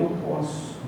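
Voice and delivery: a hushed pep talk to himself; quiet self-encouragement. Retold or imitated. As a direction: whisper it to yourself to steady your nerves. Telling himself quietly in test.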